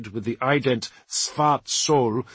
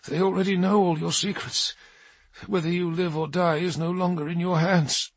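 weary Dark Angel; 6 semitones higher than usual. Acted. Ormond's held voice.

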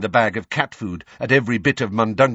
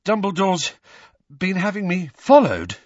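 halting, higher, winded question; incredulous boy, out of breath; panted; 10 semitones higher, 5 dB louder than usual. A narrator's reading. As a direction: ask it between breaths. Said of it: "Harry panted".

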